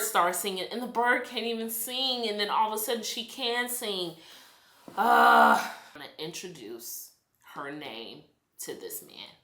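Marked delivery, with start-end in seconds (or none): none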